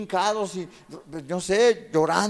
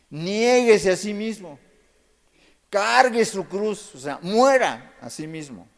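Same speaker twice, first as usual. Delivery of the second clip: loud, high and slow pulpit proclamation; fiery preaching; 6 semitones higher, 8 dB louder, slower than usual. Acted. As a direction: thunder each phrase out to the congregation. Preaching at full force. (predicación fuerte, alta y lenta)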